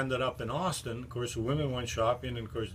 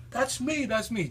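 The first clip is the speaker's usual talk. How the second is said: much higher, eager insistence; insistent; 11 semitones higher than usual. Retold or imitated. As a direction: jump in, desperate to be believed. David insists again.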